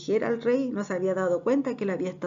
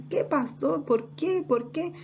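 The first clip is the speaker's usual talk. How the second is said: high, rising acted questions; bewildered pleading; 8 semitones higher, slower than usual. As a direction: ask in baffled appeal, voice lifted.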